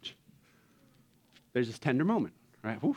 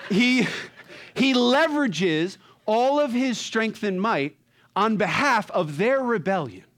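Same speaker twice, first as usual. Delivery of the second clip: louder, slow, preaching each word; excited emphasis; 7 semitones higher, 4 dB louder, slower than usual. Real, not acted. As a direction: drive it home with passion. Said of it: "I love this movie, guys".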